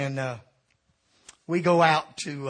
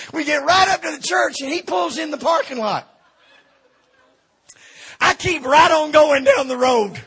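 loud and worked up, higher in pitch; comic retold fury at full volume; lost it; 9 semitones higher, 11 dB louder than usual. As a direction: belt it out like you're reliving the rage. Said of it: "I'm just lost it".

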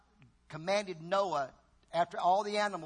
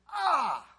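groan of regret; 7 semitones higher, 7 dB louder than usual. Shown as a demonstration. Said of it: "it meant you went".

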